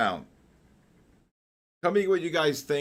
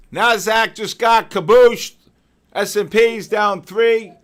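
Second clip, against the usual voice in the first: loud, higher, slow market call; excited announcing; 6 semitones higher, 9 dB louder, slower than usual. Real, not acted. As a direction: announce the drop with relish.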